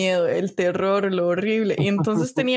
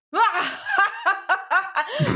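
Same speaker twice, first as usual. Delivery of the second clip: imitating a manic high laugh; crazy laugh; 9 semitones higher than usual. Friend imitating Brian's nervous manic laugh, high cackle. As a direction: cackle like a madman, high-pitched. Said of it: "se reía como un loco".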